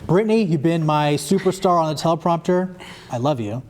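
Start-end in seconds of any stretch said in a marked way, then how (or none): none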